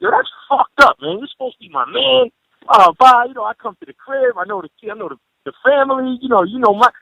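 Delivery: high-pitched mock-wounded reproach; guilt-trip your friend with playful hurt indignation; laying guilt on. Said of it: "I start laying in on him".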